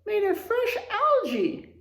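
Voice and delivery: high, bright suggestion in a character voice; cheery turtle voice. Acted. Gary the turtle suggesting lunch to his friend, high eager voice. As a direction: offer the salad eagerly as Gary. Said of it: "Gary suggested".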